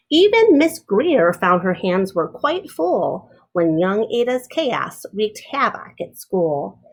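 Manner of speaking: ordinary delivery, nothing marked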